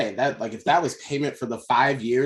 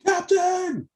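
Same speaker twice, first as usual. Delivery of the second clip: long high shout down to the deck; shouting from crow's nest. Acted. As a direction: call out across the ship.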